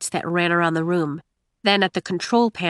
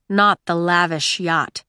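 usual speaking voice

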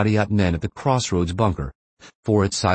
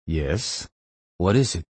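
sleepy voice; slower than usual. Acted. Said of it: "In a sleepy voice that he found hard to fake".

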